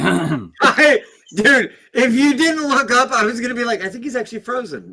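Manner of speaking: high-pitched, tickled chatter; amused, high and excitable; amused outburst. Real, not acted. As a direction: blurt it high, starting loud and easing off. Laughing reaction to cardboard cutout joke.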